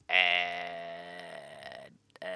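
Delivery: low, droning, drawn-out "uhhh"; long, stumped "uh". Acted. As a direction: hold one dumbfounded low note. Stumped villager reacts to regal demand.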